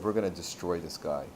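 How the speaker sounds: low, quiet plotting; understated menace, dropped low; conspiratorial. Acted. Jealous ministers plot together.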